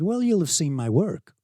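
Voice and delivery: high, loud San Diego teen impression; San Diego accent. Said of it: "do a San Diego accent".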